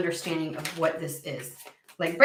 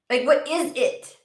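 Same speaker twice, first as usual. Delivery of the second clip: ordinary talk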